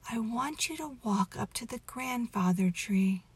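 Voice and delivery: ordinary delivery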